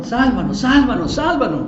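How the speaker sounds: acted cry for rescue, rising in pitch; urgent plea. Acting out a plea for salvation.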